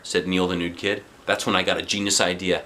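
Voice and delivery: usual speaking voice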